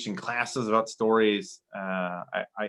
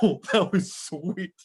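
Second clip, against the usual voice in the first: laughing; 7 semitones higher, slower than usual. Retold or imitated. Laughing reaction to story.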